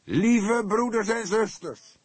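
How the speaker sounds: very high, loud, slow and drawn out; unctuous preacher. A narrator's reading. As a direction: anoint the crowd with honeyed words. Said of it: "begon hij zalvend". (zalvend als een predikant, hoog en traag)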